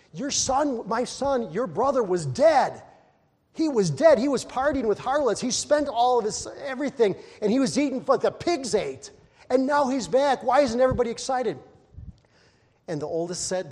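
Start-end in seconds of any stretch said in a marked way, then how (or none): 0.1-11.6 loud, high joyful insistence; father urging his older son to rejoice, loud and high; excited father. Acted. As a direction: plead with the elder son, bursting with joy. Father pleading over returned son.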